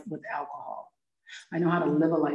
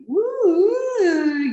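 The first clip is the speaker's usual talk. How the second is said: mock woo; 12 semitones higher, 7 dB louder than usual. Excitement of new relationship.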